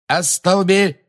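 high, urgent incantation, cut short; shrill-pitched attack cry; cried-out spell. Acted. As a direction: fling the curse out at high pitch.

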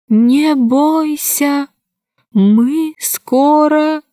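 very slow, halting dolphin speech. Acted. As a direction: push each word out with effort. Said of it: "еле выговаривая человеческие слова".

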